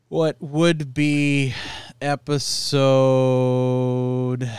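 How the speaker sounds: very slow, stretching each word; drawn-out stalling. Real, not acted. Host working out the episode number for listeners, drawn-out stalling. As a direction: hold the last word out long.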